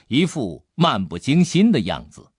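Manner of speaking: ordinary delivery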